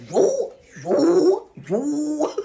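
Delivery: high, amazed yo yo yo; shocked yo. Retold or imitated. Rereading her own letter, shocked and high. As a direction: react in stunned disbelief. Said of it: "I'm like".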